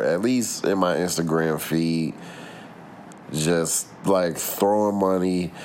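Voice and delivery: normal talking voice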